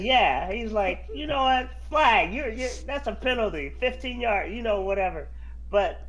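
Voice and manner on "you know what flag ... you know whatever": loud joking penalty call, higher; mock referee call. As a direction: bark it like a ref throwing a flag. Retold referee joke call.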